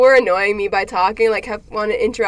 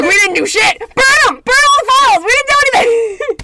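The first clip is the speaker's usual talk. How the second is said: panicked brain; 11 semitones higher, 9 dB louder than usual. Pretend. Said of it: "It's like".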